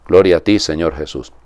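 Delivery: normal talking voice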